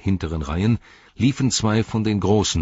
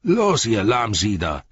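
drawn-out, raised driver's shout; called-out order; 4 semitones higher, 4 dB louder than usual. A narrator's reading. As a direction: holler slowly at the stragglers. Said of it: "rief er".

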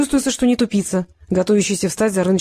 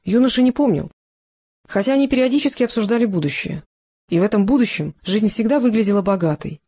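ordinary delivery

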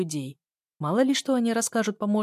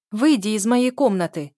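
raised, louder narrated shout; shouted order; 4 semitones higher, 8 dB louder than usual. A narrator's reading. Heroine to her sister in her room, shouting. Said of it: "крикнула я".

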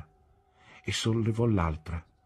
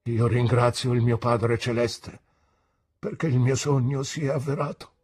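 higher, louder, moved; trembling voice; 7 semitones higher, 6 dB louder than usual. A narrator's reading. Pigotty thanks God holding his niece, trembling. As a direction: give thanks with quivering emotion. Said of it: "disse con voce tremante".

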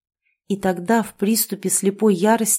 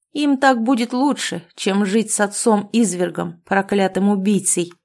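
ordinary delivery

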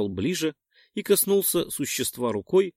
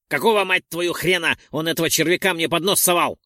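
louder, higher indignant yell; outraged; 5 semitones higher, 5 dB louder than usual. Acted. Roldon fumes about the bug shoved at him, outraged yell. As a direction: vent the outrage loudly. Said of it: "возмутился Ролдон".

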